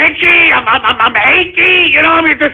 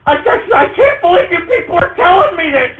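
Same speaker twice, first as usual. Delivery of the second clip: high, cracking exasperated cry; exasperated outburst; 6 semitones higher than usual. Acted. Comic host wailing at his online audience, exasperated. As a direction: wail it out in disbelief.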